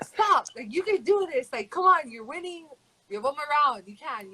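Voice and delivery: high-pitched mock coach shouting encouragement; pushy coach pep talk. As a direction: imitate the coach urging her through the last round. Coach urging fighter between rounds.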